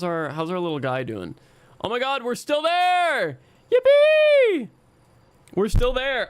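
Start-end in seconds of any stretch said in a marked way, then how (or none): 1.8-6.3 delighted high yippee; excited. Real, not acted. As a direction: cheer out loud with joy. Cheering that they survived.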